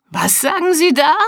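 high, loud shout of disbelief; shouting into the phone. Acted. Mister Hilarious shouting at his caller in disbelief. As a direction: yell down a bad phone line. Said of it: "schrie er".

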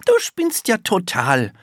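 put-on high child's voice, dismissive; scoffing little girl. A narrator's reading. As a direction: tell him off as a small girl.

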